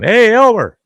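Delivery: high, loud holler to the hard of hearing; retold yell. Calling to wife's deaf grandfather, hollering. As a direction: call out as if across a forest. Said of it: "What are you yelling at me for?".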